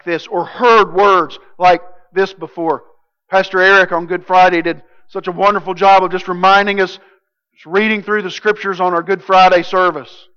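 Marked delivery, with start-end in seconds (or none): none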